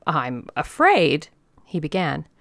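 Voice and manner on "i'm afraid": slow, drawn-out apology opener; reluctant excuse. A narrator's reading. Organizer starts excusing himself to old lady, slow and reluctant. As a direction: begin haltingly, stretching each word.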